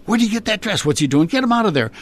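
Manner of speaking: high, mock-alarmed producer; retold outburst. Producer spots the dress, high mock outburst. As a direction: imitate a flustered boss's rapid-fire demand.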